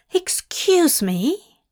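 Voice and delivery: high, drawn-out incredulous scoff. A narrator's reading. Angel told to hit Crowley, scoffing. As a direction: scoff with a swooping question. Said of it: "Zira scoffed".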